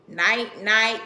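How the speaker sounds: loud mock night night; mock baby talk. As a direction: parody the two-syllable baby words. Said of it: "Stop saying everything in two syllables".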